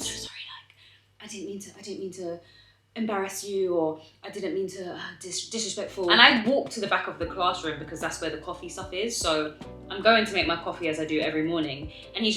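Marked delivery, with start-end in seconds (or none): 0.0-6.1 hushed whispered apology; whispering. Retold or imitated. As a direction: whisper it nervously, barely voiced. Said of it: "trying to, like, whisper apologies".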